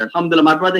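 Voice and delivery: ordinary talk, nothing marked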